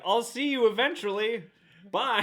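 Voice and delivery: high, loud, breezy goodbye; mock breezy farewell. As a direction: toss it off lightly.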